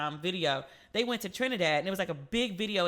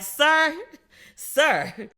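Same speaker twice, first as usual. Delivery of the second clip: much louder mock call; teasing loud call; 7 semitones higher, 8 dB louder than usual. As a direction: hype him up loudly. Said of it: "And I was like".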